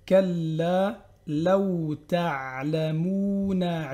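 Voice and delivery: chanted Hatta zurtumul maqabir, very slow; drawn-out chanted verse; slow recitation. Shown as a demonstration. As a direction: recite it like a Quran reader.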